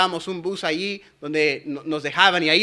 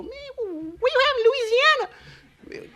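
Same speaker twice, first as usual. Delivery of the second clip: slow, high falsetto question; mimicked coworker; much slower than usual. Puzzled coworker quizzing him about Louisiana, mimicked in slow falsetto. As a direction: ask it high and drawn out. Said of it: "de repente me preguntó".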